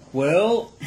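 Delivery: delighted rising exclamation; excited. Real, not acted. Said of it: "it pops".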